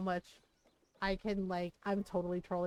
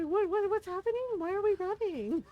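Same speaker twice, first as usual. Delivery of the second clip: high, loud confused voices; panicked bewildered guards; 11 semitones higher, 6 dB louder than usual. Retold or imitated. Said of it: "They're just like".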